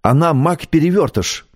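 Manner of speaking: insist, a touch indignant; lightly voiced indignant girl; mild indignation. A narrator's reading. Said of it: "возмутилась девочка".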